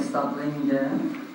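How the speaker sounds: ordinary delivery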